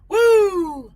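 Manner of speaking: high, loud whoop of joy; a sudden woo; excited whoop. Real, not acted. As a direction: let out a big cheer. Cheering shout to chat.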